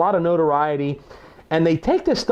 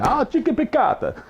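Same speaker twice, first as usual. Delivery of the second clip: mock fancy; 9 semitones higher than usual. Mocking fancy cooking talk.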